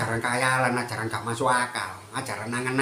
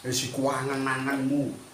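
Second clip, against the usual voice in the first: dismissive elder; 3 dB quieter, slower than usual. Retold or imitated. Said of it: "ngomongnya selalu seperti itu".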